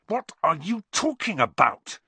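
high, heated outburst; angry demand. A narrator's reading. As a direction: demand an answer angrily, pitched well up. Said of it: "said Harry angrily".

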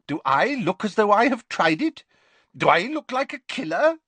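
high, affronted professor voice; indignant professor. Acted. Professor denies he has ever tried it, indignant. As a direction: protest high and forcefully.

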